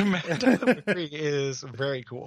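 slow, drawn-out words through laughter; laughing. Real, not acted. Co-host defending a favorite game, laughing. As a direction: say it slowly while laughing. Joking defence of Mad Dog McCree.

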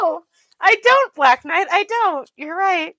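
hammed-up defeated whimper; mock-meek reply. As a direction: play a scolded kid to the machine. Answering the machine in mock shame.

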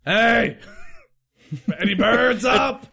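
calling out the window; hailing a taxi. Acted. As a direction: shout it up to the sky. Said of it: "like you're hailing a taxi".

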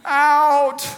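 put-on high, loud toddler wail of out; little girl in car seat yelling to get out; toddler demanding. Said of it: "She said".

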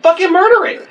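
high-pitched re-enacted desperate order; exasperated yell. Skunk holder begs cop to finish it, exasperated yelling. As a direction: yell it high, at wit's end.

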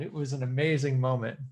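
usual speaking voice

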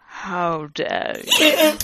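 slow, mock-indignant question; drawn-out demand close to laughter; lightly acted stern professor. A narrator's reading. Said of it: "demanded Professor Snape".

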